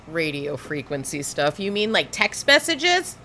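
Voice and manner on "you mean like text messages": sarcastic rise into a high squeak on text messages; incredulous mock question; mocking disbelief. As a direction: play dumb, then squeak the last words. Host mocking the claim again.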